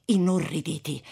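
usual speaking voice

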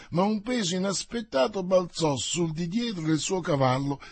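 ordinary delivery

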